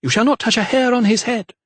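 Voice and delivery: high, forceful warning; fierce defiance. A narrator's reading. Mother defying the vicar to protect her son. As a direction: stand between him and her son.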